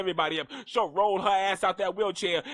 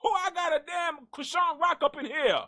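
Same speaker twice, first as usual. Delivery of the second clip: furious grandma shout; 8 semitones higher than usual. Pretend. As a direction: roar like a grandma defending her baby. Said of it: "grandbaby calls grandmama".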